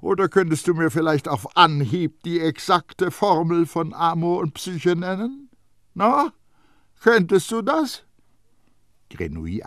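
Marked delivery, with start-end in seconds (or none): 0.0-8.0 high, loud, mocking old perfumer; Baldini. A narrator's reading. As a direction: needle the boy with high-pitched scorn. Baldini voiced by narrator.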